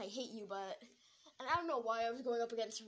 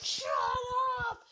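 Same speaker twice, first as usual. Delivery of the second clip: drawn-out shouted command; angry yell; 11 semitones higher, 8 dB louder than usual. Retold or imitated. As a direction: shout them into silence. Acted snap at crying girl.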